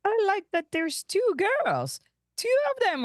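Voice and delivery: high, excited "two"; giddy boast. Acted. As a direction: squeal it out, delighted. Gleeful about two girls.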